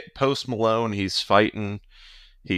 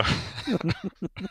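1 high laugh; 10 semitones higher, 5 dB quieter than usual. Real, not acted. Both laugh at Nintendo joke.

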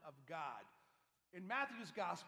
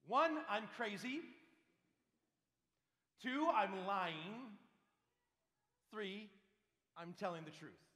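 louder, punched count; emphatic list; 2 semitones higher, 7 dB louder than usual. Acted. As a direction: hammer each option home, slow on two. Listing options emphatically.

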